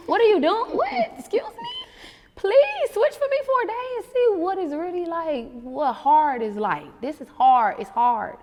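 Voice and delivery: loud, high, fed-up rant; exasperated rant. Retold or imitated. Mom ranting at childless complainers, loud and exasperated. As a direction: let them have it, incredulous. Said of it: "when I see people complaining".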